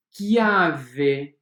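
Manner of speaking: chiave said as "kiave", hard k. Shown as a demonstration. Teacher to students showing chiave with a k. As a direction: model chiave as "kiave", no sh sound. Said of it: "Escrevemos C H e pronunciamos K".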